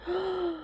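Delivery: quick breathy high gasp; delighted gasp; high soft gasp. Real, not acted. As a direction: gasp again at the clovers.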